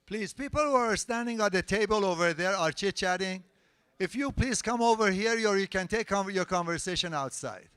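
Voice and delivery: loud and high, telling the chatters off across the room; calling out. Real, not acted. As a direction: raise your voice to hush the talkers at the table. Host calls out to chatting people.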